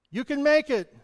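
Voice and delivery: high, loud encouragement; encouraging call. Shown as a demonstration. Preacher calls out encouragement to be echoed. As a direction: call it out to lift someone. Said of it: "what you send out".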